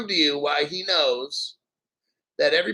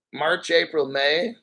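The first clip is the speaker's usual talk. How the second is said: ordinary talk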